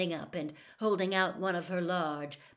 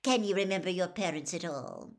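pushing on, louder; talking over him; 4 dB louder than usual. Acted. Reporter talks over Harry to ask about his parents. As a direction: roll straight over his answer. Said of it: "talking over him".

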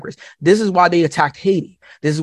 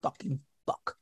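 hushed, much softer stunned realization; stunned disbelief; 5 dB quieter than usual. Retold or imitated. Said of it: "I was like".

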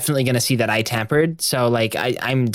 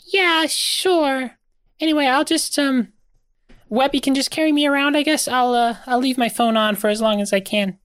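very high, squeaky and resigned; a little thing shrugging off its fate; tiny wallet voice; 13 semitones higher than usual. Acted. Grem now in wallet form.

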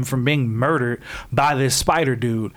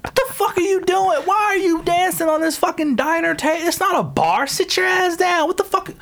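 much higher, exasperated mock scold; mock-telling characters dancing on a diner table to sit down, much higher. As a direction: scold them in a squeaky outraged voice.

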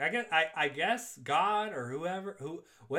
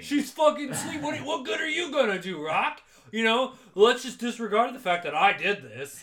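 louder, sneering Paulie imitation; mocking Paulie; 6 semitones higher, 8 dB louder than usual. Paulie jeering at Rocky by the hospital bed, louder sneer. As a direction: jeer at him like a bully. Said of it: "She's sleeping".

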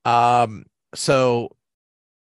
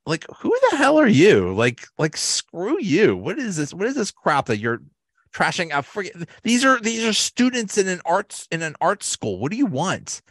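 indignant rant; 10 semitones higher than usual. Retold or imitated. Defending Pam's art against rude critics, high indignant outburst. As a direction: pitch shoots up in outrage, defending the art students.

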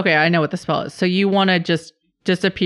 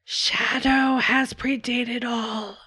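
slow, higher, eerie shadow creature; ancient beast voice; 7 semitones higher, 4 dB quieter, slower than usual. Acted. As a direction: intone it like something ageless.

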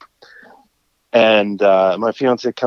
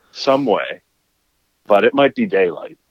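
usual speaking voice

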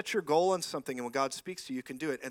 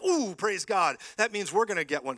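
louder, higher, thrilled ooh; excited praise; 4 semitones higher, 8 dB louder than usual. Retold or imitated. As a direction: gush like someone getting a blessing. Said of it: "you ought to be like".

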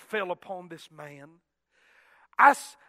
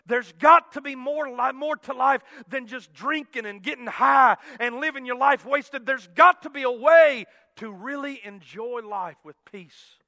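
loud, raised preacher's voice; impassioned preaching; 3 semitones higher, 9 dB louder than usual. Retold or imitated. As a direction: drive it forward with rising urgency. Same words repeated in preaching.

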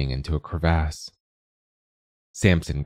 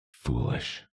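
much lower and much softer, under her breath; muttered; 5 semitones lower, 8 dB quieter than usual. A narrator's reading. Old spinster dismissing the carpenter's plan, muttered. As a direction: mutter it as she turns away. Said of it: "she muttered".

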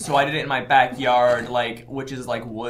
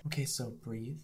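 soft guide voice; 4 semitones lower, 17 dB quieter than usual. Shown as a demonstration. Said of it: "a sample of the things he might possibly say".

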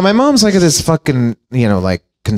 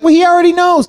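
much higher, airy mimic of the girl; high mocking girl voice; 14 semitones higher than usual. Mocking the girl to friends, high squeaky voice. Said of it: "She's like".

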